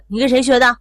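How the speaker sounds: angry questioning. Retold or imitated. Mother grabs the child and questions, sternly. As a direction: act out a parent demanding an answer. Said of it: "揪着孩子就问".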